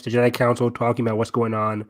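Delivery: ordinary talk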